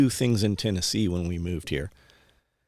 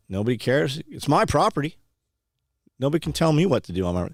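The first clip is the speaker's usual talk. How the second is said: defiant; 6 semitones higher than usual. Retold or imitated. Jeff telling the neighbor off, defiant and proud. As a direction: brush off all rules, owning it.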